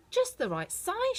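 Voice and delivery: put-on a girl thrilled with new shoes; delighted Dorothy. A narrator's reading. Dorothy tries on the shoes, delighted. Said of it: "she said".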